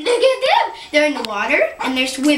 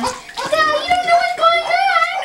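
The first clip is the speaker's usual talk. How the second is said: very high, slow cooing; high baby talk. Shown as a demonstration. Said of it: "repeated in baby talk".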